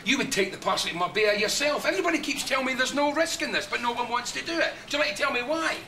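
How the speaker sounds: heated Scots protest. Acted. Scot confronting the man pushing a risky job, indignant and rising. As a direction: challenge him, pitch climbing as you go. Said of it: "keep that bloody Scotch accent of yours down".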